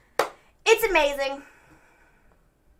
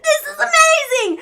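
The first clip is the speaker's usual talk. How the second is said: higher, laughing cry of delight; laughing shout; 8 semitones higher than usual. Retold or imitated. Seth delighted retold.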